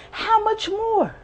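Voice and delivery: high, aching repeated question; anguished plea. Real, not acted. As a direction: cry out in despair. Anguished lament.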